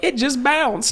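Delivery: high-pitched quoted complaint; imitating a baffled bathroom user; mock bewilderment. Retelling others' baffled reaction.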